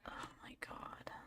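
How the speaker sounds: breathless whispered oh my God; barely voiced, stunned by what she just read; shocked whisper. Real, not acted. Reading a death threat, she whispers in shock.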